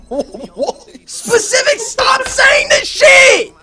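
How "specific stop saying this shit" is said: high, loud furious yell; angry shout. Real, not acted. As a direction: yell it at him, really fed up. Yelling at friend over rumor.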